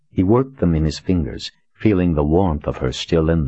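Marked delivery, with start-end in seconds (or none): none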